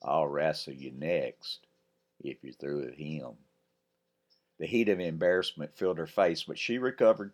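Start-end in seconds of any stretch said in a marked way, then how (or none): 0.0-3.4 slow, lower West Texas drawl; lightly voiced West Texas cowboy. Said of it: "deep West Texas drawl".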